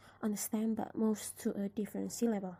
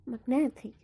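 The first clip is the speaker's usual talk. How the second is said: "magnetic" with NET punched louder; stress on NET; 5 dB louder than usual. Shown as a demonstration. Teacher pronouncing the suffixed word, NET stressed in "magnetic". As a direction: hit the middle syllable NET in "magnetic". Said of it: "The stress is in the syllable net".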